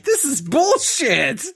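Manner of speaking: high, slow disgusted complaint; indignant. Retold or imitated. Rejected suitor complains, slow and high. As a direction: drag it out in disbelief. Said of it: "I was like".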